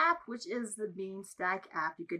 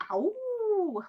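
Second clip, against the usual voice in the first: puppy howl; 11 semitones higher, 5 dB louder than usual. Shown as a demonstration. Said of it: "how the puppy sounds".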